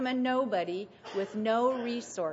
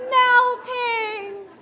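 high, much louder shriek of melting; loud witch shriek; 13 semitones higher, 11 dB louder than usual. Retold or imitated. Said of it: "what the witch said".

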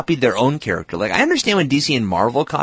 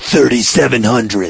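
announcer voice; 4 dB louder, slower than usual. Pretend. Deep mock announcer.

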